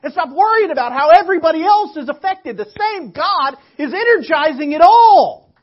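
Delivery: full-force preaching. Acted. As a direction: preach it hard to the church.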